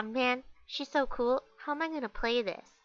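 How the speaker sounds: ordinary talk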